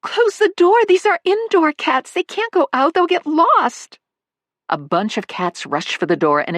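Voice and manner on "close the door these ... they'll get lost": high, loud alarmed shouting; frantic cat owner yelling, much higher; panicked yelling. Acted. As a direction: shout after the escaping cats. Cats escaping, owner yelling.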